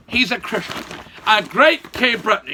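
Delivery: ordinary delivery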